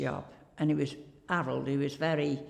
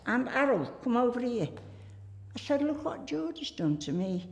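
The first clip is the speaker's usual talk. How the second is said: higher, playful call to the boss; teasing cry for help, pitched up; mock alarm; 6 semitones higher than usual. Calling the shy boss over to tease him, playfully.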